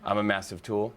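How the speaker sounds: usual speaking voice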